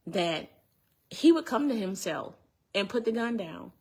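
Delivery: normal talking voice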